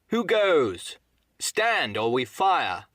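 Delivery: high, loud challenge; hailing shout. A narrator's reading. Captain hails the buccaneer from the stockade, shouted challenge. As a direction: call out a warning like a sentry. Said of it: "Then he hailed the buccaneer".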